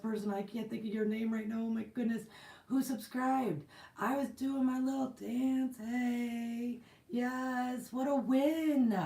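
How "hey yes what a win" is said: higher, louder, slow triumphant cheer; slow joyful cheer; celebrating. Real, not acted. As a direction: savor the win. Celebrating subscriber milestone.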